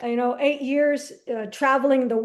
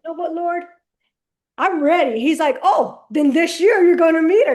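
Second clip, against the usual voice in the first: louder and brighter declaration; excited and ready; 5 semitones higher, 8 dB louder than usual. Retold or imitated. Telling God she is ready, growing excited. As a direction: announce it with building glee. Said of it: "And now I'm like".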